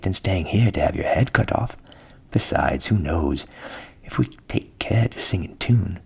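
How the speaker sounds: jolly donkey offering a plan; hearty donkey. A narrator's reading. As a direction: invite the cat along warmly. Ass persuades cock.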